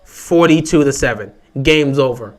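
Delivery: ordinary delivery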